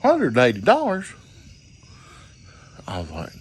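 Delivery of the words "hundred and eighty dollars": high incredulous echo of the price; outraged disbelief. Retold or imitated. Teller repeating the dancer's price, higher, outraged.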